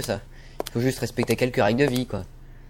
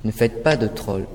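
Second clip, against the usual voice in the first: ordinary talk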